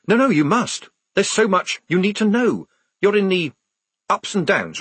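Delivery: pressing, agitated appeal; urgent pleading. A narrator's reading. Writer pleading with them to talk.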